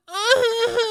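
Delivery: high, wavering fake moan; mock moan. Nephew imitating his podcast's sound in protest, mock moan. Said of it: "we're not moaning like".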